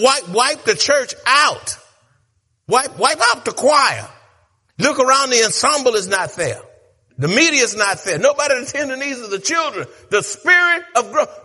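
loud, high preaching cadence; whooping preacher in full cry; full-force preaching. Acted. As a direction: drive it home with fire.

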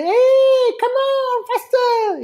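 put-on high excited Italian accent. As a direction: urge in a hammy Italian accent. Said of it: "In an Italian accent".

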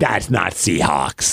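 slow, low, falling objection; drawn-out mock child's refusal; imitating stubborn daughter. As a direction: dig in slowly like a stubborn kid. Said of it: "She's like".

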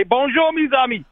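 put-on high, sing-song Bonjour with a Boston accent; hammed-up French hello from a Bostonian; Boston guy playing French, high and bright; mock French greeting in Boston accent. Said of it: "I wanted to call in and say".

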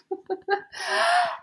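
high laugh close to tears; tearful laugh. Real, not acted. Overwhelmed at her luck, laughing near tears. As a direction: laugh and nearly cry from joy. Overwhelmed by sudden good news.

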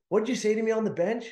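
mock coach demand. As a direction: fire the question like the stern coach. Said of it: "He said".

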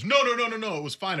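high, rapid-fire mock denial; mock protesting. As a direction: imitate a player hastily waving off concern. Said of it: "And he goes".

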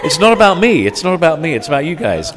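laughing loudly through it; high, loud, laughing joke. Real, not acted. Host jokes after bragging.

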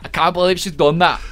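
mock Geordie quote, opening loud; playful go at a Geordie accent; imitated Geordie accent. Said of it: "the accents".

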